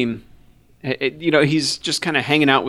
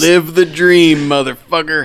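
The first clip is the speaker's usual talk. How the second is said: slow cheering shout; 5 semitones higher, 5 dB louder, slower than usual. Acted. Riffing as cheering gun store owner.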